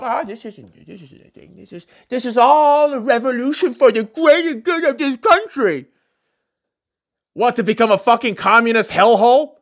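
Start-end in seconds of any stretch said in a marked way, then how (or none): 0.0-5.9 mocking leftist voice. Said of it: "Keep telling yourself".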